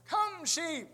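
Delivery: put-on high, loud kid's holler; young boy calling. Eight-year-old son giving it a try, boyish holler. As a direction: call out like an eager young boy. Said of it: "his eight-year-old son said".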